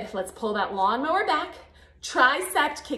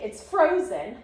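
ordinary talk, nothing marked

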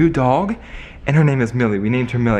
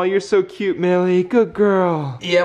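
ordinary delivery

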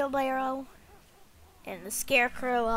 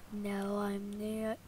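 slow, low, quiet reply; play-acted dying murmur; feebly sick; 5 semitones lower, 12 dB quieter, slower than usual. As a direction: answer weakly as if poisoned. Poisoned character answers feebly.

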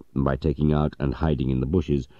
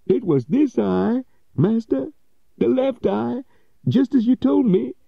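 much higher, earnest servant voice; eager old servant; 3 dB louder than usual. Acted. Old servant assuring his master, high earnest voice. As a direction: answer your master earnestly.

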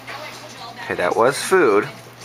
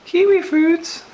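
high sing-song echo; slower than usual. Real, not acted. Repeating the child's words.

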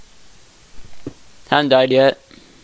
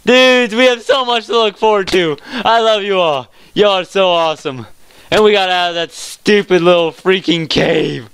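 high, loud elation, gradually settling; elated celebration; 7 semitones higher, 4 dB louder than usual. Real, not acted. Gamer celebrating the cave escape with viewers, elated. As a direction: gush to the fans in triumph.

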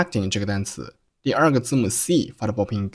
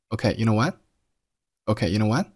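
"you know what" lifting at the end; slow questioning rise on "what"; rising intonation; slower than usual. Shown as a demonstration. Teacher modelling the line for learners with rising intonation. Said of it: "这个句子语调上扬".

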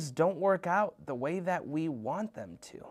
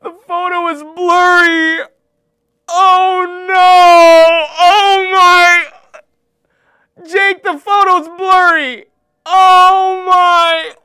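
much higher, much louder anguished wail; wailing despair; 13 semitones higher, 20 dB louder, slower than usual. Acted. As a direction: wail over the ruined photo. Ruined photo meltdown.

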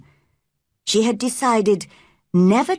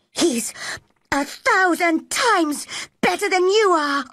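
put-on high-pitched angry girl; heated retort; 12 semitones higher than usual. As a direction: hit back hotly in a high voice. Mary snaps back in quarrel.

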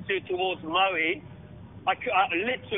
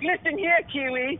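tough comeback; 6 semitones higher than usual. Retold or imitated. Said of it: "I was like".

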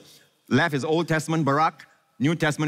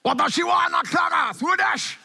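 mock yelling lover. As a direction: bellow it across the park like a silly lover. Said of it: "Lovers don't yell".

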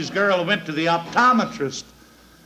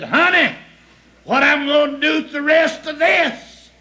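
pleading wife; 7 semitones higher, 5 dB louder than usual. Acted. Wife calling from shore.

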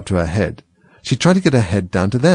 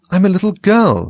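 doubtful; 9 semitones higher, 4 dB louder than usual. A narrator's reading. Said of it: "rather doubtfully".

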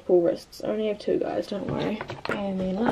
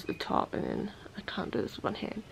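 whisper; 11 dB quieter than usual. Real, not acted. Fumbling phone, muttering aside.

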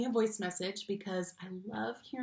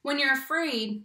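lively, expressive picture-book reading; reading to children. Acted. Teacher reading a picture book to her class, expressive storytime voice. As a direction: read it like storytime for little kids.

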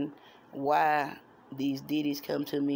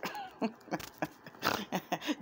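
high snort laugh; 7 semitones higher, 7 dB quieter than usual. Real, not acted. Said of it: "I snort when I laugh sometimes".

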